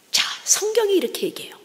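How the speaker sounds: hushed, confiding whisper; whispered aside. Real, not acted. Preacher whispering to the congregation before scripture. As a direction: lean in and whisper it.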